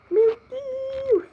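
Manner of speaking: high, loud oh my God; panicked exclamation. Real, not acted. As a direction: blurt it out in panic. Panic in game.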